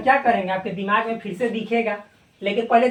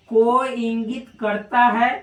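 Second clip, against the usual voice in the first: normal talking voice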